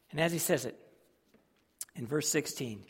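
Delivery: ordinary delivery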